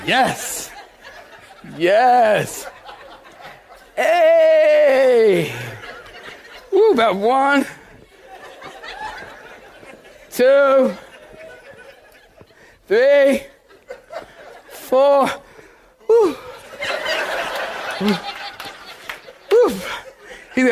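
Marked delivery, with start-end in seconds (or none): 0.0-5.5 high, drawn-out revival cries; hammed-up whooping while running laps; mock church fervour. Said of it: "So we run around the church. I'm like". 6.7-19.7 high, puffed lap count; mock running laps. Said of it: "So we run around the church".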